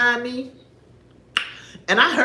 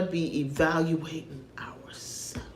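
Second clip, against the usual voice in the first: whispered; 6 semitones lower, 11 dB quieter, slower than usual. Real, not acted. Drops to a hush to close the point.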